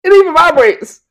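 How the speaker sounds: high, laughing cry of shock; laughing disbelief. Real, not acted. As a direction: squeal through laughter at the ad.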